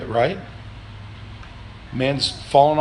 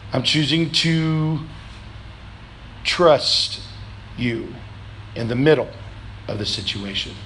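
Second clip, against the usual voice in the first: quiet surrender; 4 semitones lower, 6 dB quieter than usual. Pretend. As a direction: let the words fall away in surrender. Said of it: "surrendering our will and saying".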